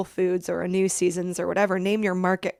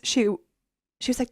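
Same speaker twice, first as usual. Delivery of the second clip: ordinary delivery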